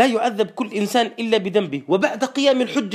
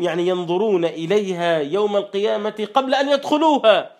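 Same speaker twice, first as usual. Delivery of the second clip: usual speaking voice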